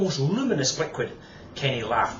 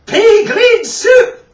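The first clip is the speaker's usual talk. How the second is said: very high, loud, slow reply breaking into laughter; laughing punchline; 17 dB louder, much slower than usual. Acted. The kids answering the toilet joke, laughing loudly. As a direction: shriek it as you crack up.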